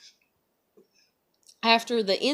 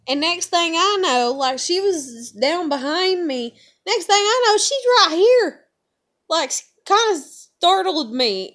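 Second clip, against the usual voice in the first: startled excitement; 9 semitones higher, 8 dB louder than usual. Real, not acted. Reliving being startled at work.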